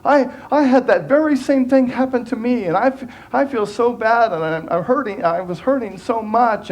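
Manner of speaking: high-pitched hammed-up me-too; gushing oversharer. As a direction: cut in eagerly and make it all about you. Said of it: "she said".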